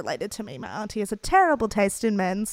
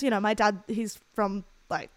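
ordinary talk, nothing marked

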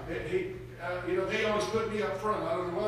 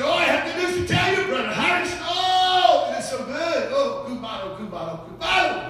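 excited news; 7 semitones higher, 6 dB louder than usual. Retold or imitated. Brother excitedly tells good news.

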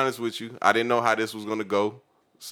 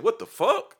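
high, loud, shocked outburst; outraged shout; 6 dB louder, slower than usual. Retold or imitated. Teen yelling at the judge, loud shocked disbelief. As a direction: blurt it out in disbelief.

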